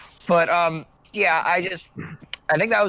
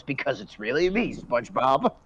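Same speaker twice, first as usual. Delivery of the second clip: lightly imitated deep cartoon voice; 4 semitones lower than usual. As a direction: drop a bit lower to mimic him. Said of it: "he has this really deep voice".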